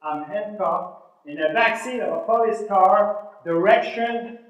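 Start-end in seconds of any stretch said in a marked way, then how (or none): none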